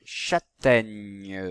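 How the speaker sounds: teacher's lengthened CHÂTAIGNE; sounding out. Shown as a demonstration. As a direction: stretch CHÂTAIGNE so the opening ch is clear. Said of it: "Si vous entendez le son".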